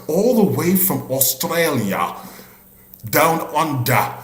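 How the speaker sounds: butchered, hammed-up Aussie accent; put-on Australian announcer drawl, badly faked. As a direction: stretch out a clumsy mock Australian accent. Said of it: "your Australian accent there".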